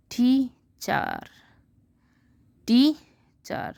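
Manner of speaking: Teacher stretched slowly, rising high, split TEE-char; slow syllable split. Shown as a demonstration. Teacher sounding out the word for the class, slow and high. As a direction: sing out teacher in two slow beats. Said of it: "divide is odd in syllable".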